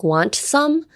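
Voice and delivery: "Want some?" with the T sounded, lifting at the end; over-separate "Want some?", T fully said; T pronounced. Shown as a demonstration. English teacher showing what not to say, T pronounced. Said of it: "You wouldn't say, like".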